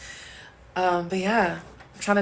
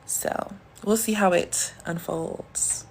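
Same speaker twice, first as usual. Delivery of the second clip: softer, slowed, sultry demo of her voice; deep smooth voice; 7 dB quieter, much slower than usual. Shown as a demonstration. Showing viewers her deep smooth voice, soft and slowed. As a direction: let it sink low and smooth, savouring it. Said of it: "I have a very deep and smooth voice".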